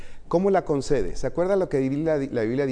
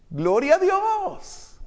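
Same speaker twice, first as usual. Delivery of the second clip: mock gloria; much slower than usual. Exclamación burlona muy aguda.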